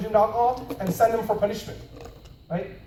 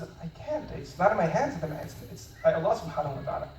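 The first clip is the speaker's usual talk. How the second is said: calm fatherly refusal; 4 semitones lower, 8 dB quieter than usual. Retold or imitated. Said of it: "very calmly".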